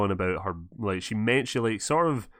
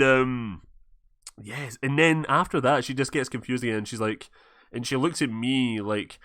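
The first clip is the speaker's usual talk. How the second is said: ordinary talk, nothing marked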